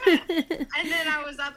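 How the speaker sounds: loud, high laughing words. Real, not acted. Mother recalls being woken up by her son, laughing. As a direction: talk right through the laugh.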